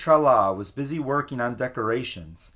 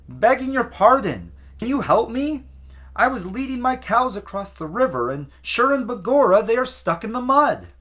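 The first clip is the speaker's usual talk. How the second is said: high, louder pleading call to the crowd; yelled plea; 10 semitones higher, 4 dB louder than usual. A narrator's reading. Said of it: "The little man yelled out".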